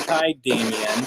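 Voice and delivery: faint voice in radio static; distant broken radio voice; radio tuning in. Real, not acted. Voice heard through spirit box.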